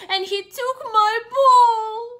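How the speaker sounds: high, loud, slow wailing sob; crying piggy. Acted. Piggy wailing to Gerald that his ball was taken. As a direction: wail it like a heartbroken little pig. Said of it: "He's crying really, really hard".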